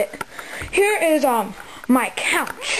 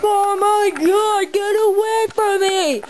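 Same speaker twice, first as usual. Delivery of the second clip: higher, slow, hammed-up panic; mock scared cry; 9 semitones higher, 3 dB louder, slower than usual. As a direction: act startled awake by screaming fans. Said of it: "There's screams and I'm like".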